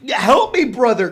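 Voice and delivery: loud, high-pitched plea; cry for help. A narrator's reading. Drowning soldier to his brother, crying out. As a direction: call out urgently to be rescued. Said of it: "cried the soldier".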